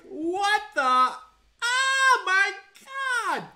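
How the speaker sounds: high, loud, stretched-out disbelief; amazed gasp. Retold or imitated. Remembering the first time Ken held the note, awestruck. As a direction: squeal it like you can't believe your ears. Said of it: "I was like".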